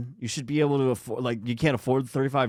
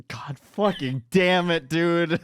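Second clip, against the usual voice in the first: drawn-out, higher-pitched frustration; exasperated cursing; 7 semitones higher, 3 dB louder, much slower than usual. Real, not acted. Reader trips on the word again, exasperated outburst. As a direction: curse your own slip, slowly. Annoyed at own repeated misreading.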